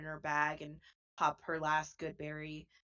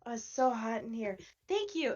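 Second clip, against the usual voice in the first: higher, relieved and pleased; delighted Chip; 9 semitones higher, 3 dB louder than usual. Acted. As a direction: sigh into the cool breeze. Chip enjoys the cool breeze.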